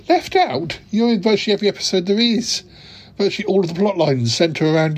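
can't believe the excuse, jump up in pitch; outraged "Left out?" and a quick tumble; indignant disbelief. Acted.

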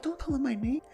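panicked retold plea. Storyteller begs his friend not to name him, comic panic. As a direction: beg in a squeaky hush, played for laughs.

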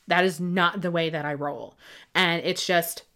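ordinary delivery

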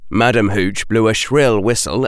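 plain reading voice

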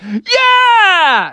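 high, loud eager "Yeah!"; excited frog shout. A narrator's reading. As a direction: yell it like you can't wait. Froggy eager for a bite.

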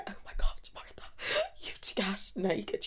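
breathy, whispered mock sobbing; imitated bawling. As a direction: act out a tearful, hushed panic between gasps. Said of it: "She came out bawling, crying".

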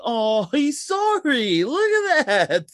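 high-pitched, drawn-out mock sympathy. The women answer the driver's apology, mocking. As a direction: taunt him with exaggerated pity.

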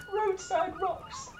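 slow words between loud high laughs; can't stop cracking up; laughing. Real, not acted. Breaks up over the wok joke.

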